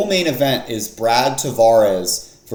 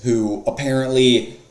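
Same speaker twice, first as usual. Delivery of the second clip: ordinary talk, nothing marked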